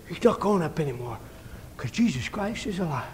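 low, soft, subdued statement; hushed conviction. Retold or imitated. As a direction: drop right down after the shout. Hushed after the shouted proclamation.

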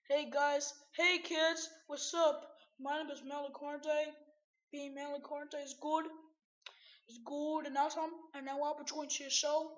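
loud, peppy presenter voice; show host greeting. Pretend. Melicorte greets the kids at the show, loud host intro. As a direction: play the host, projecting to a crowd.

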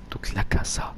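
unvoiced whisper aside. Real, not acted. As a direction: breathe it out as a whisper.

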